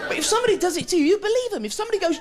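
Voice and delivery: ordinary talk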